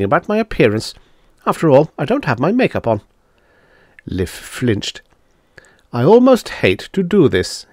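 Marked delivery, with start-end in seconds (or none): none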